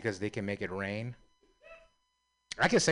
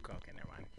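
whispered aside; 5 semitones lower, 17 dB quieter, much faster than usual. Real, not acted.

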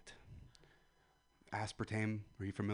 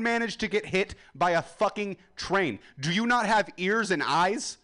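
yelling; 8 semitones higher, 5 dB louder than usual. Real, not acted. Comic yelling at the crowd.

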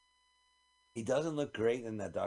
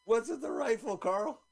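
very high talking-duck voice; duck impression; 12 semitones higher than usual. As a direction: ask it as a squeaky cartoon duck. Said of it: "Did you hear my duck impression".

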